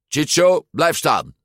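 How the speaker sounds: annoyed bully ordering his friend to stand still; irritated. A narrator's reading. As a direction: snap at him to stay put. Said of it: "zei Ercole geërgerd".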